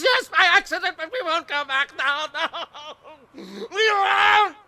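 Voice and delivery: high, loud panicked denial; frantic shout. Acted. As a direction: refuse it in a frantic cry. Gollum frantically denies going back.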